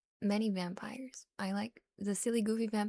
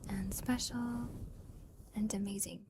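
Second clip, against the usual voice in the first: ASMR whisper; 5 dB quieter than usual. Acted.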